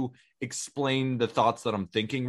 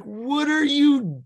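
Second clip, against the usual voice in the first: high, stretched-out disbelief, much higher and louder; incredulous mock question; 14 semitones higher, 6 dB louder, much slower than usual. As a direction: stare someone down with a baffled squeal. Mimicking a Karen's baffling behavior.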